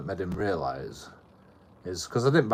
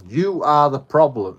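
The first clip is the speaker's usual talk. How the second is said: loud, slow, higher retort; forceful accusation; 6 semitones higher, 9 dB louder, slower than usual. Retold or imitated. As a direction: re-enact standing up to him, drawn out and loud. Retold confrontation, firm accusation.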